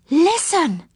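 startled, high-voiced alert; sudden alert. A narrator's reading. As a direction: gasp and call attention. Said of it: "Silky suddenly stopped".

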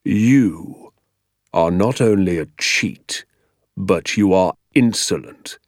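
slow, controlled condemnation; deliberate, icy verdict on the boy; quiet menace. A narrator's reading. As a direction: measure out each word dangerously. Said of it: "he said quietly".

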